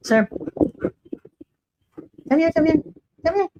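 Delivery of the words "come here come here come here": high coaxing call; sing-song pet call; calling the dog. Real, not acted. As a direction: coax the dog over to you.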